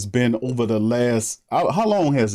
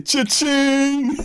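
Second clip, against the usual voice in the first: cash in with glee; mock Jameis cheering his payday; gleeful cha-ching; 14 semitones higher than usual. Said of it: "That's what Jameis is saying".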